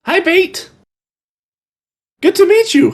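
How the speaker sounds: put-on high-pitched twelve-year-old boy; Piper. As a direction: pitch it up as a small kid.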